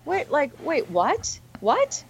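stunned, pitch shooting up on the last what; reliving the shock of hearing it; incredulous what. Retold or imitated. Hearing the friend's advice, reacting in disbelief.

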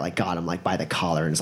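usual speaking voice

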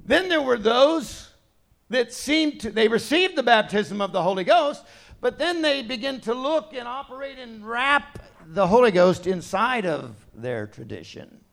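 ordinary talk

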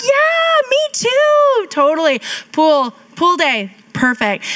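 high, squeaky mock chirpy yes; fake enthusiastic agreement. Newlywed faking delight to husband, high chirpy voice. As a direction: gush agreement you don't mean. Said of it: "I'm like".

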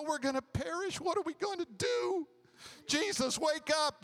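mock panic, pitched well up; panicked disciples.

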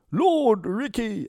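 acted high-pitched scream. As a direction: cry out to the captain in panic. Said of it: "Asker screamed again".

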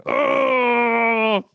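long, high cry of pain; mock pained wail. Patient feeling every inch of needle, mock pained wail. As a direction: draw out a high, acted wail. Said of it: "I feel every inch of the needle".

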